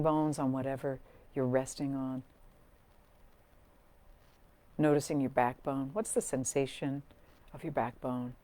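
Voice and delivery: soft, calm meditation voice with long pauses; guided meditation. Real, not acted. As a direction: gently guide them into breath and body, leaving space between phrases. Leads a grounding meditation.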